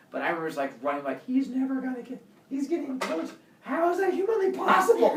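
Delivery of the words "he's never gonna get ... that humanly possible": high, rising, laughing disbelief; panicked disbelief. Retold or imitated. Kid being caught up to, in high disbelief. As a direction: squeak out your shock.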